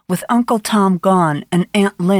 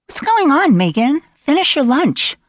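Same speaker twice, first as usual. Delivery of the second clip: put-on higher girl's voice; insistent girl; 8 semitones higher than usual. A narrator's reading. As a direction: press her to stay. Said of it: "one of the girls insisted".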